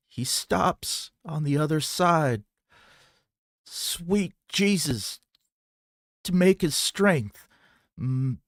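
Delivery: high, slow, strained frightened voice; terrified babbling. A narrator's reading. Terrified woman gasps the answer to the gunslinger. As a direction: stammer it out in terror.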